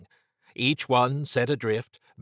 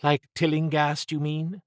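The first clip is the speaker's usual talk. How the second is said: woman's voice; 6 semitones higher than usual. A narrator's reading. Trish's quoted question.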